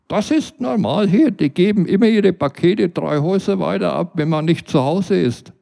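a nosy neighbour mocking him; neighbour Britta. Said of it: "meinte sie".

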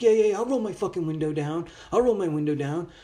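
mocking yeah-yeah brush-off; sarcastic other driver; sarcastic mock reply. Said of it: "he's looking at me like".

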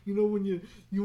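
ordinary delivery

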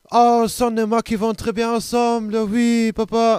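high, loud, over-the-top French; fake French. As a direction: gush in mock French at a stranger. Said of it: "mit Fake-Französisch".

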